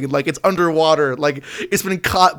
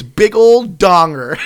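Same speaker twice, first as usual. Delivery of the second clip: slow, loud drawn-out mock shout; 3 semitones higher, 6 dB louder, much slower than usual. Reading a troll's comment aloud to Gabbo, slow hammed-up shout. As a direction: stretch it out and yell the punchline.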